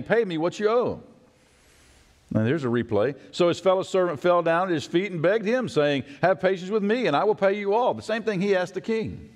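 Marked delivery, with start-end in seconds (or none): 0.0-1.0 high, grabbing demand; demanding payment. Acted. Servant throttling his debtor, mild harsh demand. 6.2-7.9 high, pleading beg; begging servant. Acted. Fellow servant begging for mercy, pitched high. Debtor begging at his feet.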